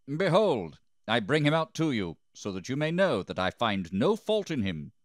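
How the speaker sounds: loud, high call easing toward the end; public announcement. A narrator's reading. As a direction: proclaim it to the crowd. Pilate announces to the crowd.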